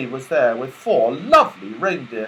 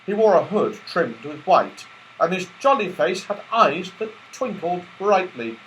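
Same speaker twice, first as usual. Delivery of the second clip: usual speaking voice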